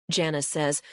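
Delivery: plain reading voice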